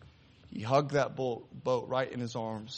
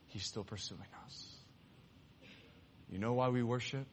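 quiet altar call; 6 dB quieter than usual. Acted. Preacher closing over music, pleading softly with the congregation. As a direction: draw them in gently.

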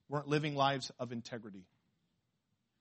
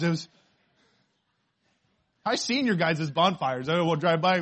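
ordinary talk

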